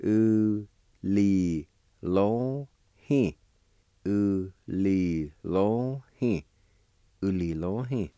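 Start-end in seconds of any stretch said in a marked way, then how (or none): 0.0-3.3 U, li, lo, hin each set apart with short pauses; Cherokee syllable by syllable. Shown as a demonstration. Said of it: "it's pronounced like this". 4.0-6.4 U, li, lo, hin run a little closer together; Cherokee word repeated. Shown as a demonstration. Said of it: "it's pronounced like this".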